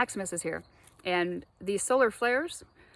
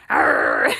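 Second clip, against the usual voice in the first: loud growling roar, higher pitch; cornered dog snapping; 6 semitones higher, 9 dB louder than usual. Retold or imitated. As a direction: let out a kicked dog's sudden roar. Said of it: "and he's like".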